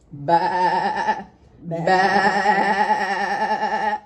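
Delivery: bleating baa like a lamb; sheep baa. Shown as a demonstration. Host bleats like a sheep for the kids. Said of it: "Next, we have a sheep".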